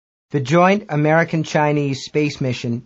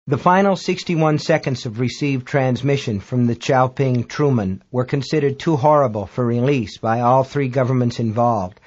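usual speaking voice

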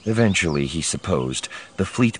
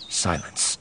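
hushed; 7 dB quieter than usual. A narrator's reading. Pause before silence.

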